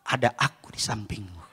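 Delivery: very low, hushed comfort; calm husband. Retold or imitated. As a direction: murmur protective reassurance. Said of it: "Saya bilang". (suami menenangkan, sangat rendah dan lembut)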